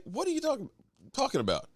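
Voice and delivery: high disbelieving outburst; incredulous. Retold or imitated. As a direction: pitch shoots up in disbelief. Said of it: "it was just like".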